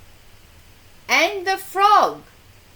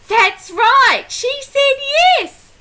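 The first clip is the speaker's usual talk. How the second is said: much higher and louder, thrilled; excited praise; 10 semitones higher, 9 dB louder than usual. Real, not acted. Reader praising the child's guess, high and loud.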